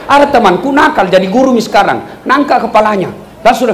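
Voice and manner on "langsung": ordinary talk, nothing marked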